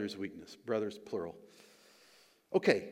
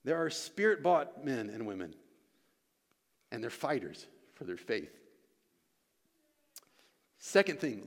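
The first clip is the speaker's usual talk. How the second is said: ordinary delivery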